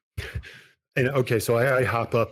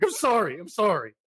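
much higher, breathless sorries; fleeing apology; 9 semitones higher than usual. Acted. Vampire fleeing the salon, high panicked apology. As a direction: cry it back while running off.

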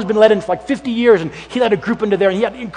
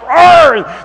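hammed-up pirate growl, higher and louder; pirate "Arr!"; 7 semitones higher, 6 dB louder than usual. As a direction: bark it like a cartoon pirate. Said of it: "like the Pirates of the Caribbean".

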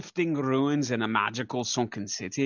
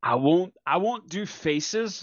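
ordinary talk

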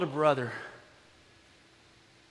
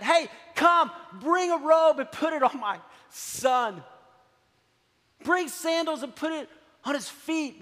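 father's joyful call; 7 semitones higher than usual. Retold or imitated. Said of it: "you know what the dad says?".

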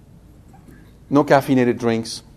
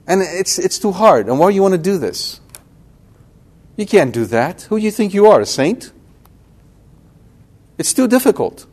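devil tempting; 4 semitones higher than usual. Retold or imitated. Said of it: "He's right there whispering all of this".

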